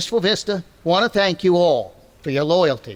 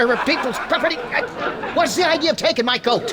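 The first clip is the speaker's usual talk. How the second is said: blustering tough talk; 8 semitones higher than usual. Acted. McGee blustering at Wimple.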